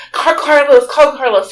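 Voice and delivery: loud, high, repeated order; acted frantic plea for help; urgent panic. Aunt ordering a call to her husband, frantic.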